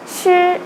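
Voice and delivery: sh held high and level; first tone. Shown as a demonstration. Teacher recapping the first tone. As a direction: keep sh flat and high. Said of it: "the first tone was".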